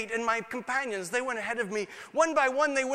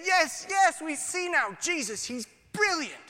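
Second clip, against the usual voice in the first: excited; 7 semitones higher than usual. Retold or imitated. Companions excited while running.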